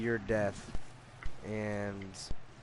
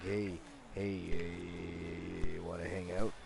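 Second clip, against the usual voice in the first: cult leader voice; 5 semitones lower, 4 dB quieter, much slower than usual. Retold or imitated. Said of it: "cult leader voice".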